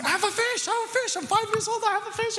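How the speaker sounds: put-on very high excited kid voice; a boy shrieking over his first fish; squealing child. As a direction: squeal it like a thrilled five-year-old. Five-year-old's joy.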